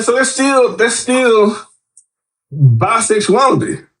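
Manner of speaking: high-pitched, drawn-out disbelief; incredulous retort. Retold or imitated. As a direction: stretch out bisexuality in disbelief. Retorting in retold conversation.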